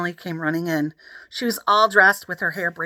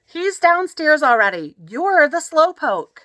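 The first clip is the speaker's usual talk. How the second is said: bossy sister; 8 semitones higher, 5 dB louder, slower than usual. A narrator's reading. Emily scolding brother.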